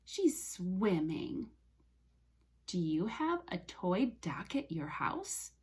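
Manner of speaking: usual speaking voice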